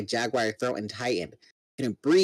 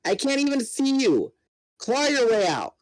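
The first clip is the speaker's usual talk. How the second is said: much higher urgent shouting; yelling; 10 semitones higher than usual. Acted. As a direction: shout orders desperately. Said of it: "I yelled".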